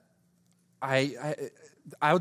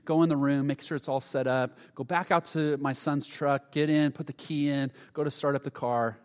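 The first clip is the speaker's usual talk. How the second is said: ordinary talk, nothing marked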